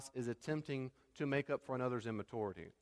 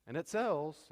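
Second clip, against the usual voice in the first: ordinary talk, nothing marked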